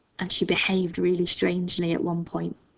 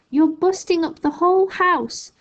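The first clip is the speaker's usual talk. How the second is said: put-on higher, urgent boy's protest; calling back; 9 semitones higher, 4 dB louder than usual. A narrator's reading. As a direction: shout the worry upward. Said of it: "George called back".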